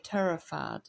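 ordinary delivery, nothing marked

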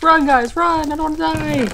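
mock flee for your life; being chased. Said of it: "I'm gonna act like we're being chased".

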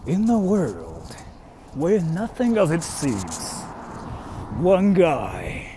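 low, slow, quiet trailer narration; movie trailer voice. Shown as a demonstration. Streamer doing a commercial on request, deep trailer voice. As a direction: intone it like an epic film trailer. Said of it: "Do a C M commercial? Sure".

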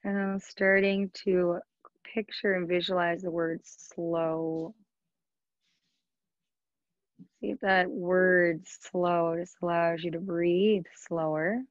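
unhurried yoga nidra guidance with long pauses; guided meditation. Acted. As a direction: guide them into relaxation, leaving space between phrases. Leads a yoga nidra practice.

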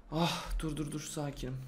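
low, soft muttering to himself; hushed and low under pressure; self-calming. Real, not acted. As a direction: settle yourself down, voice dropping away.

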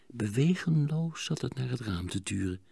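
usual speaking voice

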